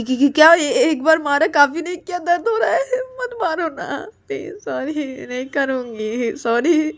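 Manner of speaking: high, whimpering mock-crying plea; tearful pleading. Ananya begs Payal not to hit, tearful pleading. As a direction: stammer in alarm, then whine out the apology.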